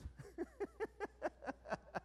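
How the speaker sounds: long, high-pitched burst of laughter; high giggling laugh. Real, not acted. Joking about giving a plumber the script, high laugh. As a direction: giggle away in a high, rapid string.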